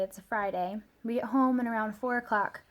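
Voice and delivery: usual speaking voice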